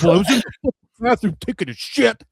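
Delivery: hammed-up outraged retelling; mock indignant Jay. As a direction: complain like the wronged victim. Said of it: "And Jay's like".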